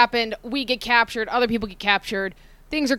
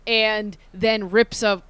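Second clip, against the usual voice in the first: ordinary talk, nothing marked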